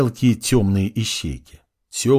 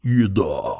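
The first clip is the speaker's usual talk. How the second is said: gurgling creature; 3 semitones lower than usual. A narrator's reading. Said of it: "пробулькала огромная черепахоподобная тварь".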